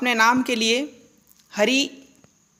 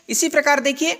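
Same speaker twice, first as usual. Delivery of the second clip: ordinary delivery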